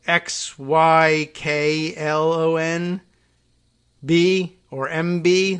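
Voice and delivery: slow letter-by-letter spelling; spelled out. Shown as a demonstration. Reading out a garbled transcript, spelled out slowly. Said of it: "it came out as, like".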